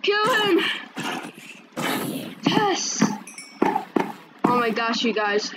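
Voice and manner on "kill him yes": high, eager battle cries; egging himself on as he lands hits; excited. Real, not acted. Player strikes the monsters, high excited cries.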